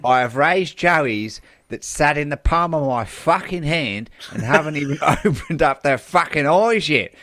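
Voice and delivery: broad sweary Australian wildlife carer; Australian accent. Acted. Kangaroo carer quoted in a news story, broad Australian accent. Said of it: "I hope my Australian accent".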